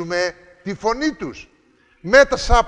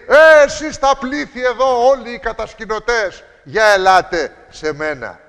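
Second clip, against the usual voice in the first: high, loud call to the crowd; mock crowd call; 4 semitones higher, 5 dB louder than usual. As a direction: hail a faceless crowd of campers. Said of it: "Δεν λέει".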